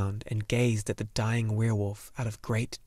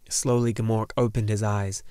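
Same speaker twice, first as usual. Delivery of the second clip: ordinary delivery, nothing marked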